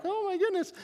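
tiny high-pitched worried squeak; squeaky, half-joking dismay; mock alarm. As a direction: act out a comic little panic in falsetto. Scrawny narrator dreading a confrontation.

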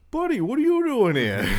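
high, loud, thrilled greeting; a drunk dad overjoyed to see his son; all excited. Retold or imitated. Drunk dad surprised by son's midnight visit, thrilled high greeting. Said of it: "He's all excited".

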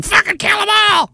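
high sneering mock war cheer; mocking warmonger. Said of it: "While you're here going".

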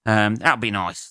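ordinary delivery

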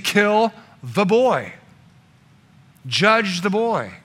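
slow, heavy mob demand; crowd's verdict. Acted. Crowd hearing Jesus demanding judgment, slow and heavy. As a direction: drag it out like a condemning crowd. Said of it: "the crowd ... was saying".

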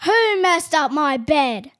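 slow, loud angry growl; papa bear finding his bed messed. A narrator's reading. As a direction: bellow it in anger. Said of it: "an angry voice".

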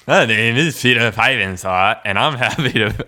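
put-on contestant voice; different voice. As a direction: introduce yourself in a made-up voice. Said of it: "I'll do a different voice".

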